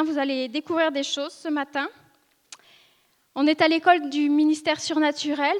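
ordinary talk, nothing marked

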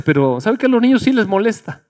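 normal talking voice

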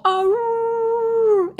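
very high, wild howling cry; wolf howl. Retold or imitated. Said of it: "Was that a wolf howl?".

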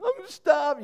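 put-on high whiny kid's complaint; a boy whining for food. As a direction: mimic a hungry boy's whine. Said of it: "When they whine".

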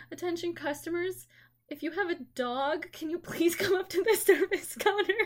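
mock store intercom call; PA announcement. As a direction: cashier calling over the intercom. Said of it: "get over the intercom and be like".

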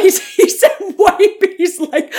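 talking through real laughter, high-pitched; laughing. Real, not acted. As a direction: crack up while getting the words out. Cracking up at co-host's joke.

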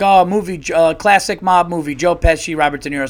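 loud, animated clue-giving; imitated game player. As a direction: blurt out clues in a guessing game. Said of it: "People be like".

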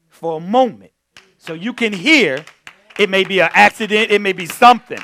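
loud, rising preacher's delivery; preaching. Acted. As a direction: drive the point home to the church. Preaching to congregation.

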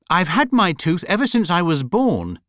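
put-on high, aggrieved young bear; hurt and put-out complaint; indignant. A narrator's reading. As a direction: protest it, pitched well up. Paddington's indignant parting shot.

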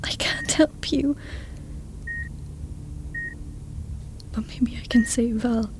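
unvoiced whisper. Whispering an apology beside the hospital bed. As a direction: confess it under breath.

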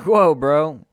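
high, loud protest; loud mock outrage. Churchgoer objecting to the preacher's shoes, loud mock outrage. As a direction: call out in exaggerated disbelief.

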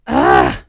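loud, short scream; startled scream. A narrator's reading. Scully face to face with Mulder, startled scream. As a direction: scream out in fright. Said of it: "she screams".

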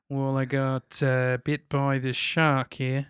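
lightly voiced mellow Aussie bloke; mock Australian accent. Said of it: "an accent".

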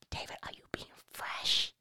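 whispered scandalized question. Real, not acted. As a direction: hush it, shocked. Scandalized aside to David.

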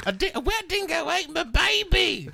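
put-on high, loud Australian "dingo ate my baby"; Australian accent. Joking cohost quoting the famous line, high Australian accent. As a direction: fling out a mock Australian cry. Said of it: "that Australian accent".

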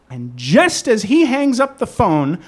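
ordinary talk, nothing marked

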